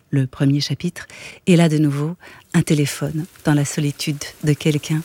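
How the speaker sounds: ordinary delivery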